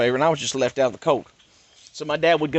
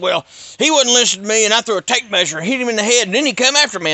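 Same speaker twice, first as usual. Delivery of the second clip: high, louder whiny father voice; mocking his dad; 9 semitones higher, 7 dB louder than usual. Dad tells the cop his side.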